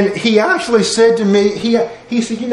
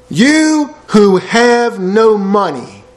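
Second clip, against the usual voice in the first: very slow drawn reading; 3 semitones higher, much slower than usual. Shown as a demonstration. Preacher savours Isaiah's line, very slow and drawn. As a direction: stretch every word out slowly.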